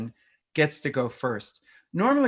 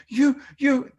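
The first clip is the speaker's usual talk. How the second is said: put-on high, loud mirroring of a toddler's upset; toddlerese; 11 semitones higher, 5 dB louder than usual. Shown as a demonstration. Parent mirrors an upset toddler, high and loud in toddlerese. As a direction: echo the child's big feelings in baby talk. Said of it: "mirroring a third of their emotion".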